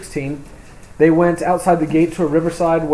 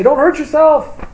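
high, loud and slow call to stop him; a desperate shout across the jail; urgent cry; 12 semitones higher, 8 dB louder than usual. Acted. Paul calls out to jailer.

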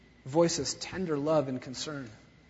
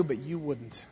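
soft, quieter sorrowful line; sorrowful lament; 4 semitones lower, 8 dB quieter than usual. Acted. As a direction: sigh it out in grief. Preacher voicing Jesus' lament.

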